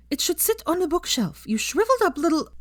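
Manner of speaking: put-on haughty high-pitched girl voice, fussing; prim know-it-all lecturing about pillows; Pansy, bossy and high. A narrator's reading. Pansy lecturing Hermione on decorating, haughty and high. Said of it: "Pansy stormed over to Creature".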